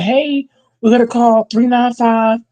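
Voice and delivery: lightly mocked meek coach; soft coach voice. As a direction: mimic a soft-spoken coach. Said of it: "that is soft shit".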